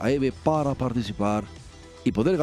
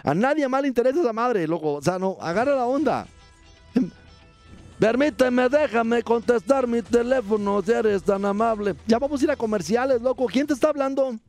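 raised, hammed-up sidekick voice; high comic character voice; 9 semitones higher than usual. (voz de personaje cómico aguda)

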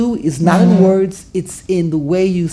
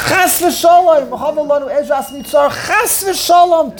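emphatic denial; 6 semitones higher than usual. Acted. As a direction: exclaim it as an impossible thought. Acting David's emphatic denial.

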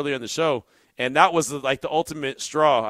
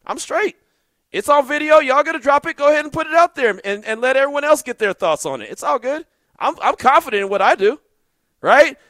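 fired-up retort; 12 semitones higher, 6 dB louder than usual. Retold or imitated. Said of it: "I was like".

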